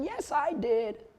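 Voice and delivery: high, slow sing-song customer voice; lightly voiced customer on a call; mock customer. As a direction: play the sweet customer. Imitating a customer on the phone.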